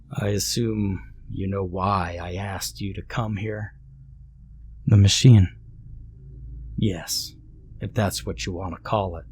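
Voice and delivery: put-on quiet, level old man; a lightly voiced calm stranger.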